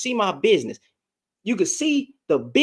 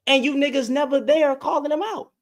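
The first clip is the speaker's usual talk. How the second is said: usual speaking voice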